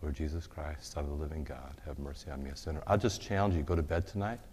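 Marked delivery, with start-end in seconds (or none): 0.0-2.8 soft, hushed prayer; hushed Jesus prayer; quiet prayer. Shown as a demonstration. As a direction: say it softly to yourself. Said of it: "God's merciful and he'll use you".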